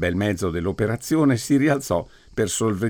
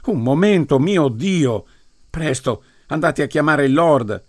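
higher, louder frantic calls; alarmed shout; 6 semitones higher, 4 dB louder than usual. A narrator's reading. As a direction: cry out in panic. Crowd panics over the wine.

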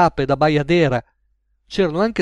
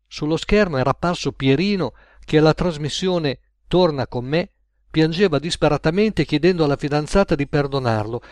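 plain reading voice

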